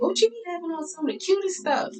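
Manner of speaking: high, squealing mock fan; mock admiring follower. Viewers asking about her outfits, high mock gushing. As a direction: gush like an impressed viewer. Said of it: "a lot of y'all be like".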